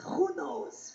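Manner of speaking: Russian mother. Retold or imitated. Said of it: "And she says".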